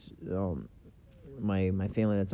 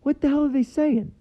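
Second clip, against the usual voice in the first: high-pitched, louder mock exasperation; baffled outburst; 5 dB louder than usual. Baffled viewer reacting to Downton Abbey speech, high-pitched exasperation. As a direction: squeal it in comic disbelief.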